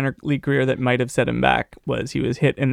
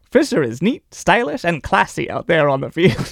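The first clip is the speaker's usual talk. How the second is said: high, hammed-up sportswriter voice; put-on vintage columnist; old-time newspaper read; 5 semitones higher than usual. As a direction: read the gushing profile theatrically, laughing near the end. Reads an old newspaper profile aloud.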